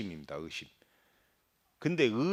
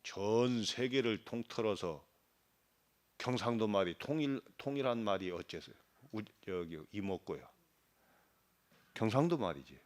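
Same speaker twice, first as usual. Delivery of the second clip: normal talking voice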